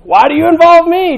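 high, loud exasperated Woman; indignant son. Retold or imitated. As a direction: throw up your hands at your mother. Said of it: "I'm never calling my mom woman".

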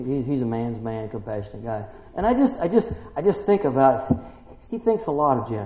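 ordinary talk

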